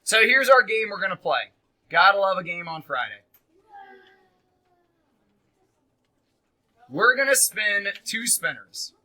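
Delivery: very loud classroom announcement; calling out. Real, not acted. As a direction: project loudly to the whole class. Teacher calls out game to class.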